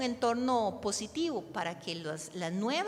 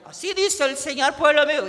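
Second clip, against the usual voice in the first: booming mock-prophet shout, pitched up; voz fingida; 5 semitones higher, 14 dB louder than usual. Shown as a demonstration. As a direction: fake a thunderous prophet voice. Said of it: "Y que yo finja la voz". (voz de profeta fingida, muy fuerte y aguda)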